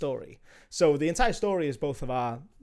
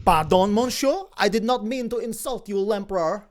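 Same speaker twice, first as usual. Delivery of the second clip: put-on theatrical French accent, a little louder; 4 semitones higher, 5 dB louder than usual. Crew member apologising to the emperor, French accent. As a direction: play it as a courteous Frenchman. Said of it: "You sound French now".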